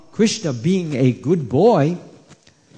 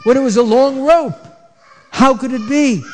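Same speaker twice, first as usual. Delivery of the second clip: higher, louder, baffled asking; puzzled mother; 8 semitones higher, 4 dB louder than usual. A narrator's reading. Storyteller voicing mother's puzzlement over the rope: higher and louder bafflement. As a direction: raise it up in disbelief. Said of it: "she's trying to understand".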